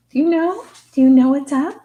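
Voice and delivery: excited cooing at the dog; loud baby talk to the dog. Shown as a demonstration. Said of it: "I baby talk with the animals a little bit".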